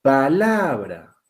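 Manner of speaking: "Palabra" drawn out with LA stressed; "pa-LA-bra", stretched out to show the stress. Shown as a demonstration. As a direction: slowly lean on the middle syllable of "Palabra". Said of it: "aquí está la mayor fuerza de voz".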